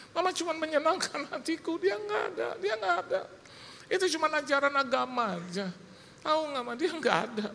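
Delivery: high-pitched retold protest to mother; distraught protest. Retold protest of doubting son. (protes anak kepada ibu, melengking)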